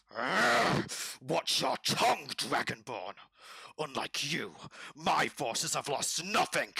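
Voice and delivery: growling, high, loud lizard outburst; angry lizard. Acted. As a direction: roar it as an enraged lizard commander. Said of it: "The lizard then goes".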